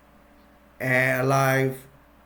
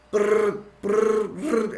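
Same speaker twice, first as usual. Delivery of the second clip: repeated rolled brrr, higher pitched; machine-gun bursts; 6 semitones higher, much slower than usual. Retold or imitated. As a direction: imitate bursts of gunfire with your lips. Imitating machine gun fire.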